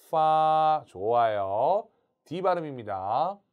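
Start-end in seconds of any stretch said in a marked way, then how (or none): 0.1-0.8 loud 파 held level; long first tone. Shown as a demonstration. Teacher asking for long 일성, sustained level 파. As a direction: stretch 파 on one even pitch. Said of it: "일성 길게 하세요".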